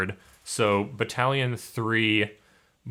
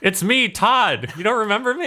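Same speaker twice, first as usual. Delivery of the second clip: Todd; 12 semitones higher, 3 dB louder than usual. Acted. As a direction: pipe up very high, like an eager crewman. Game master voices crewman Todd.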